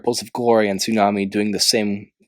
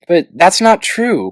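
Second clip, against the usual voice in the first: slow, higher, stress on not; protesting; 8 semitones higher, 4 dB louder, slower than usual. A narrator's reading. Clay protesting to Tsunami about Glory, slow stress. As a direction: lean hard on not. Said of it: "Clay protested".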